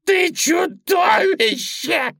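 high, loud, drawn-out shriek; outraged squealing yell; screaming rabbit. A narrator's reading. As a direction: howl the accusation as the bunny. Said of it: "завопил кролик".

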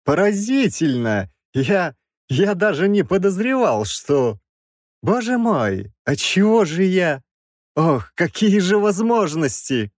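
high, halting wonder; amazed professor. A narrator's reading. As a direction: marvel aloud, stumbling over the thought. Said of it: "воскликнул Краевский".

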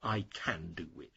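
the teacher tosses off the wrong form quietly; wrong version, dropped. Shown as a demonstration. Teacher shows students the incorrect form, quietly. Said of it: "and never".

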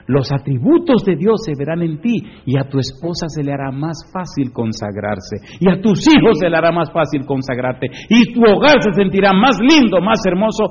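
rising steadily to high, loud fervour; building preaching. Real, not acted. Preacher promises blessings for wife, children and home, fervent. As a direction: pile up the promises with growing force.

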